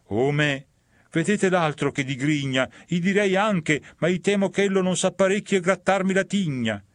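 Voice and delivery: frightened sinner eyeing the grinning devil; fearful sinner. Acted.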